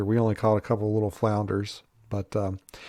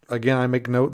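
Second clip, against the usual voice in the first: normal talking voice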